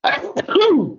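sudden dry cough; a sharp cough that breaks off. Real, not acted. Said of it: "Excuse me".

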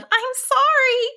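high, frightened little sheep voice; gasped apology. A narrator's reading. Sheep trying to apologize to an angry wizard, high frightened gasp. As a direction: squeak out a desperate apology. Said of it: "tried to gasp out an apology".

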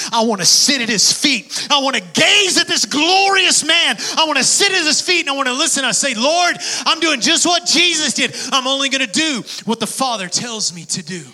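high, loud preaching cry; impassioned shout. Real, not acted. Preacher cries out his devotion to the Lord, loud and high.

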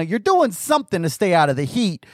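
usual speaking voice

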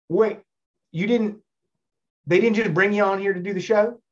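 imitated incredulous Southern man; Southern accent. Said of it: "I do the Southern accent".

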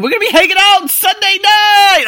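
excited. Retold or imitated. Vlogger gushing about seeing his friend, excited. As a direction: sing out the plan with glee.

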